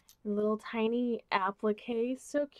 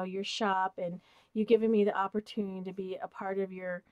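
ordinary talk

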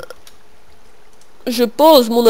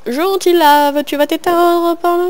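cooing to lava; 7 semitones higher than usual. Pretend. Talking sweetly to lava.

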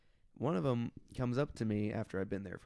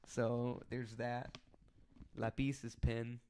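ordinary delivery

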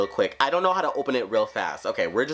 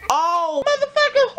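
furious scream; 5 dB louder than usual. Real, not acted. Killed by Granny.

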